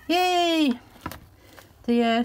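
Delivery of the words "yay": delighted yay. Real, not acted. Opening a gift parcel.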